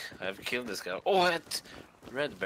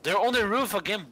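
high, loud alarm; excited; 10 semitones higher, 5 dB louder than usual. Real, not acted. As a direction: call it out in alarm. Spots enemies on roof.

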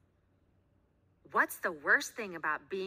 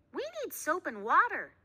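put-on high eager girl; 10 semitones higher, 6 dB louder than usual. A narrator's reading. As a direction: chirp it brightly. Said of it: "said Gabriella".

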